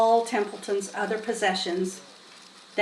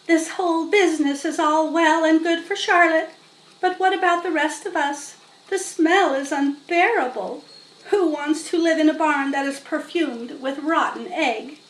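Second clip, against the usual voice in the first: higher, whiny lamb voice; complaining lamb; 6 semitones higher than usual. Acted. Lamb complaining to the barn about the smell. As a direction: grumble like an aggrieved lamb.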